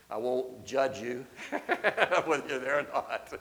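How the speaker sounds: chuckling through the words; joking with a laugh. Real, not acted.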